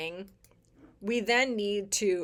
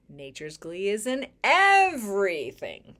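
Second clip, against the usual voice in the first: everything rising and swelling louder; stress on everything; 4 dB louder than usual. Shown as a demonstration. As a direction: lean into everything, let it bloom. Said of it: "the emphasis lies".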